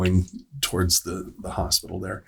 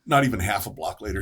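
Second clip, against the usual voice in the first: normal talking voice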